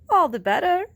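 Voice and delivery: high, loud groundhog. Acted. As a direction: answer briskly as groundhog.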